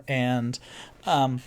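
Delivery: usual speaking voice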